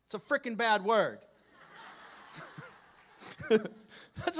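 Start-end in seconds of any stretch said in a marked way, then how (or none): 0.0-1.2 slow, louder mock parent warning kids; a hint of a stern parent, drawn out; parent scolding. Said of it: "what you would tell your kids".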